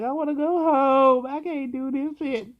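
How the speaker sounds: high, loud wail of misery; mock-sobbing I wanna go home; crying complaint. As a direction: whine like you're at the end of your rope. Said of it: "I was crying".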